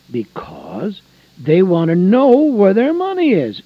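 rising fervent preacher call; preaching at full force. Real, not acted. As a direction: preach it hard to the congregation.

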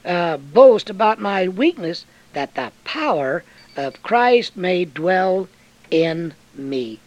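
loud, slow, ringing scripture reading; proclaimed scripture. Acted. Preacher reading Corinthians to the flock, slow and ringing. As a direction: declaim the verse from the pulpit.

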